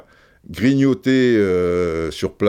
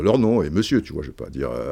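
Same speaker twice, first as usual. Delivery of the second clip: usual speaking voice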